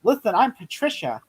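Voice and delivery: lightly badly faked girl's voice; mock female voice. Actor mocking a one-note Patricia, put-on female voice. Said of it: "do a female voice".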